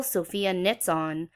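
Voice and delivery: ordinary delivery, nothing marked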